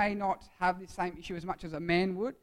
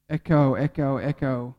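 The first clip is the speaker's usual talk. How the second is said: low repeated echo, dropping away each time; imitated echo; 7 semitones lower, 4 dB louder, slower than usual. Shown as a demonstration. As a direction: call it like a cave echo, fading.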